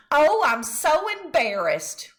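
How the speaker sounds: drawn-out, high fretting mother; fussy embarrassment. A narrator's reading. Mother fretting to her son in the shoe store. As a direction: lay the embarrassment on thick. Mother fussing over sock hole.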